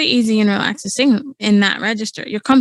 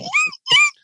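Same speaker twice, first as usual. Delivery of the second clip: squeaky laugh. Shown as a demonstration. Mimicking the squeak.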